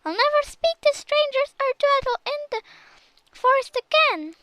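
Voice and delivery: high, fast tearful promise; sobbing. A narrator's reading. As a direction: still sobbing as she swears to behave.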